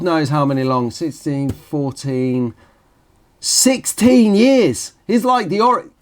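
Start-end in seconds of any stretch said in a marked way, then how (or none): 3.4-5.9 high, drawn-out exclamation of amazement; amazed. Real, not acted. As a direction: marvel at it, pitch leaping up. Amazed on working out the years.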